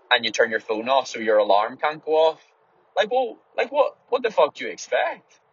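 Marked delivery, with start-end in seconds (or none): 3.0-5.4 exasperated. Real, not acted. Exasperated rhetorical outburst.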